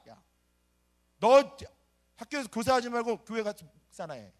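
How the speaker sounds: high, very loud furious scolding; a boss screaming at a teacher, furious; principal's angry yell. Retold or imitated. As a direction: bark it like an outraged principal. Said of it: "막 욕하면서 저한테 한마디 했습니다".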